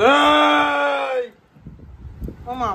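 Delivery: long, high held shout; calling out. Real, not acted. Son calling his mother who ignores him, drawn-out shout. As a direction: stretch out one high call for attention.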